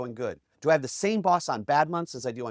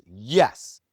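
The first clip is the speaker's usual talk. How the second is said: firm, punched single word, low and louder; certain, energetic; 5 semitones lower, 6 dB louder than usual. Retold or imitated. Reporter assuring the tightrope walker, certain and energetic. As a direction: answer with total conviction. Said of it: "more certainty and more energy".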